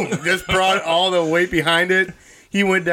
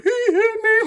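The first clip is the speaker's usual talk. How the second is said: put-on much higher, whining little kid; whiny kid brother. Little brother telling dad on him, a high whining tattle. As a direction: whine it like a tattling child. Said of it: "my brother was like".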